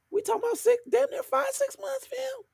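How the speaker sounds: very high, squeaky protest; incredulous. Real, not acted. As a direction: let disbelief push the voice way up high. Disbelief at how long she was dead.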